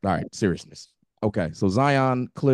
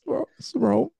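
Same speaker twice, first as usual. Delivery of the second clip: high-pitched, laughing protest, getting louder; laughing disbelief; 4 dB louder than usual. Real, not acted. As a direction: squeal it out through laughter, can't believe it. Incredulous reaction to phone bill.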